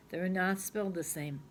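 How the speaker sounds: ordinary talk, nothing marked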